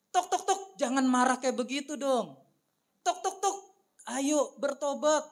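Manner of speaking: high sing-song knock, coaxing lines; playful knocking Jesus. Acted. Jesus knocking at the heart's door, high and coaxing. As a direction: coax the listener to repent.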